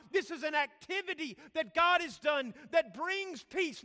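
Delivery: loud, high, impassioned sermon delivery; forceful preaching. Real, not acted. Preacher telling the congregation of no peace, impassioned. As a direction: drive each phrase out at full force.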